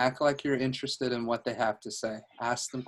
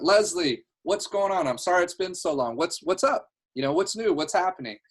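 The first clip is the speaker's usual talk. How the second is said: higher, upbeat catch-up chatter; best-friend energy; 5 semitones higher, 4 dB louder than usual. Shown as a demonstration. Coach demonstrates calling someone long unseen, chummy and energetic. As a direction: reconnect eagerly with an old pal. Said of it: "a certain type of energy that he had".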